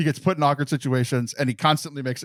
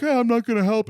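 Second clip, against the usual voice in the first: mocking; 7 semitones higher than usual. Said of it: "And she's like".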